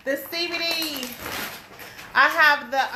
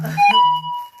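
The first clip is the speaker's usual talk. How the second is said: high burst of excited laughter; delighted laugh; 6 dB louder than usual. Real, not acted. Vlogger laughing with glee at her finished shirts. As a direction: laugh out with pure joy.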